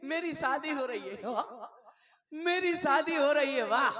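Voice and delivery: हँसते हुए ज़ोर से, उत्साह में; सपने में शादी की खुशी में चहकना; खुशी की चीख. Pretend. (दूल्हा खुशी से हँसते हुए चिल्लाता)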